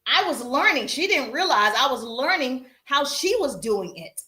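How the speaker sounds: normal talking voice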